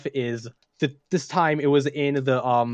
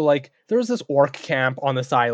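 ordinary talk

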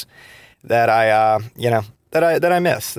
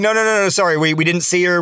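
flustered apology; 6 semitones higher than usual. Retold or imitated. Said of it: "we apologized and everything".

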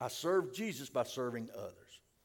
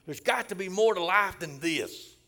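raised, loud plea of someone fed up; higher, louder quoted outburst; desperate congregant; 6 semitones higher, 10 dB louder than usual. Retold or imitated. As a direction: voice a retold cry for something more. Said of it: "some people have even told me".

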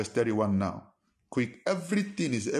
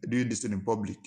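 ordinary talk

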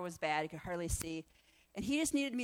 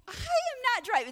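refusing; 6 dB louder than usual. Retold or imitated. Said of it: "And I was like".

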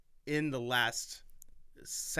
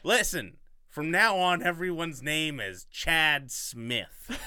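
loud, higher mock-exasperated announcement; mock decree; 5 semitones higher, 9 dB louder, slower than usual. Host joking after botching a name, mock-exasperated decree. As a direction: lay down the law in jest, slowing on the name.

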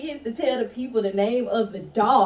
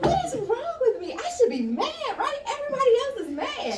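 high, laughing self-questioning; laughing confusion; 14 semitones higher than usual. Retold or imitated. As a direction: giggle through the confused thoughts. Retold high confused thoughts.

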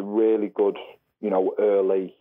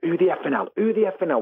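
high mock-angry shout at the players; retold angry yell; 9 semitones higher than usual. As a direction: replay the manager's half-time rage, much higher. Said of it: "like, getting angry".

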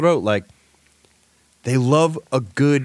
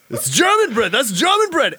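mock German insistence; 10 semitones higher than usual. Mocking the German man.

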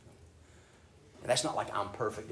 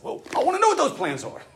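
high, loud mock demand; half-hearted indignant asker; 8 dB louder, faster than usual. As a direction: voice the impatient question to God, way up high. Preacher voicing his own demand.

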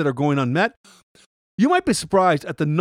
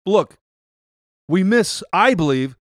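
usual speaking voice

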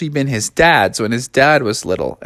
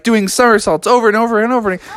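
ordinary delivery, nothing marked

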